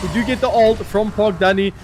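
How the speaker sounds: excited caster over the action; play-by-play casting. Acted. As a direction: call the fight as it unfolds. Live casting a team fight.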